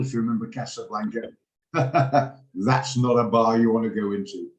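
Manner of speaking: ordinary delivery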